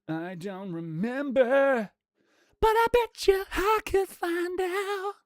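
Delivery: slow, drawn-out, climbing very high; playful high voice. Pretend. Streamer musing to chat about a card set, slow playful high voice. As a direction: stretch it out, rising into falsetto.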